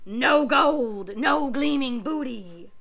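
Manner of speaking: loud, bitter pirate complaint; frustrated pirate. Acted. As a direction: let the disappointment burst out. Pirate disappointed.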